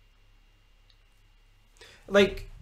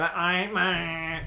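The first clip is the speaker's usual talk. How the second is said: whiny, nasal junkie drawl; nasal Ned. Acted. Mimicking Glasgow junkies for listeners, weird nasal voice. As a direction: talk through the nose like a Glasgow Ned. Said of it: "talk this weird nasally way".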